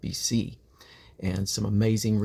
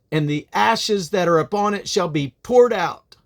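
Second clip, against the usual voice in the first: much louder and higher on Behold; booming proclamation; 10 semitones higher, 7 dB louder than usual. Acted. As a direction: thunder the sign like a prophet. Prophet announces the sign.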